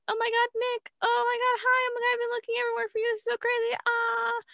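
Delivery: very high, fast squealing rush of joy; gushing excitement. Retold or imitated. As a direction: squeal it out like a long-lost friend. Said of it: "And then she's like".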